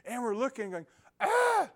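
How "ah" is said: sudden high scream, much louder; a short panicked cry of alarm; retold scream. Scream as the rafters lose sight of her.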